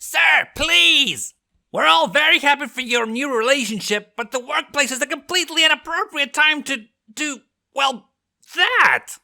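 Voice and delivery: high, loud scandalised assistant voice; shouted scolding. A narrator's reading. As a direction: shriek it like a shocked, prim employee scolding the boss. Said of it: "and shouted".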